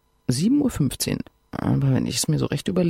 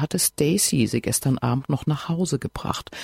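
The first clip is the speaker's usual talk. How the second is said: ordinary delivery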